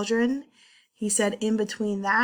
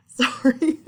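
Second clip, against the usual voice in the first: a quick laugh, then sorry; laughing apology; 5 semitones higher, 6 dB louder than usual. Real, not acted. Presenter laughing at her own slip, laughing apology. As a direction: laugh it off lightly.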